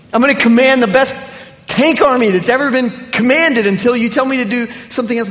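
a servant bursting to serve the king; loud, overjoyed servant pledging to the king; eager servant. Acted.

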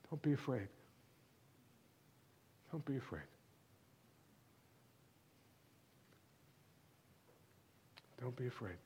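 soft, hushed, repeated comfort; gentle reassurance. Acted. Preacher softly reassuring the congregation in their storm. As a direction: say it quietly, as if calming a frightened child.

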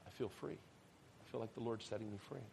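very soft, hushed relief; hushed retold wonder; quiet awe. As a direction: say it softly, as if newly released. Retold relief after long crying.

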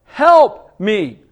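loud pleading cry, Lord stretched. Acted. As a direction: beg the Lord for help out loud. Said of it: "The woman cries out and says".

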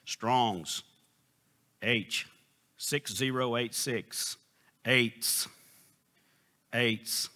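a hint of a concordance app reading out entries; imitated audio pronunciation guide. Preacher imitates his iPad reading the entry, lightly mimicked recording. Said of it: "button that'll tell you how the word sounds".

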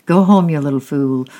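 loud scolding command; ordering. A narrator's reading. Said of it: "ordered Tyke".

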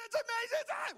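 very high playground yell; excited screaming. Shown as a demonstration. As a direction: shriek it like recess just started. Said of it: "I'm screaming".

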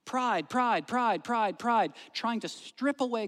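high, loud, driving sermon delivery; impassioned preaching. Real, not acted. Preacher warning a congregation about pride, impassioned. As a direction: preach it hard at full force.

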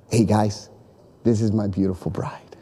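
low, soft words fading to a whisper; hushed and proud, presenting his bride; tender presentation. Retold or imitated. Groom presents the unveiled bride.

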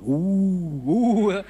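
hammed-up spooky ooh, breaking into a laugh; playfully spook the empty factory; laughing mock ghost moan; mock ghostly oohs. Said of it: "desperate to go around going".

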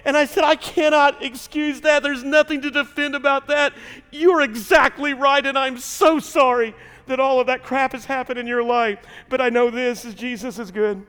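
high, loud, impassioned preaching; preaching at full force. Acted. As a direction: build the testimony to a fiery peak. Preaching climax of the story.

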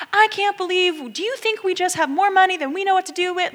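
high, fast indignant rant; incredulous student griping; mouthing off. Retold or imitated. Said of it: "the guy continues to mouth off".

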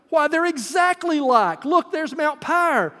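high, slow, amazed, stressing "exactly"; excited cry. Acted. Edmund recognising the mountains of Narnia, high excited cry. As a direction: point it out with wide-eyed, high delight. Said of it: "cried Edmund".

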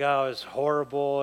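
low, mildly grumpy reply; retold grumbling. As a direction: imitate grumbling about a bad evening. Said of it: "I was just kinda grumbling".

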